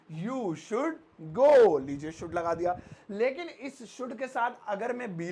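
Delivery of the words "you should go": each word drawn out slowly and loudly; slow dictation. Shown as a demonstration. Teacher dictating to students, very slow and loud. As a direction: spell it out for the class, very slow.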